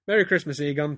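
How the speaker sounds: high, loud, over-bright festive greeting; too much pizzazz. A narrator's reading. Peter greeting Egon in a story, overdone pizzazz. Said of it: "too much kind of pizzazz".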